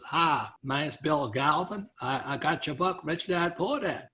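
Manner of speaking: slow Dallas drawl on the greeting; thick Dallas accent. Retold or imitated. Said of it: "thick Dallas accent".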